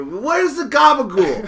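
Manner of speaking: loud, high mock old Italian lady; slow hammed-up nonna calling for gabagool; old Italian woman. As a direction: call out like a nonna by the river. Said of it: "She's eighty-five".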